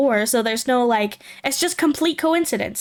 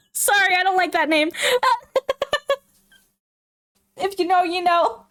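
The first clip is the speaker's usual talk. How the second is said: higher voice breaking into laughter; real giggling; 8 semitones higher than usual. Real, not acted. Vlogger rejecting a dog name, giggling apologetically. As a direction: get the apology out between giggles.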